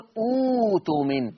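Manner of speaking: "أوتمن" opening on a long oo; hamza turned to waw. Shown as a demonstration. Teacher starting from the word itself, "أوتمن" with long oo. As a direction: start "أوتمن" with a held oo, no second stop. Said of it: "هكذا".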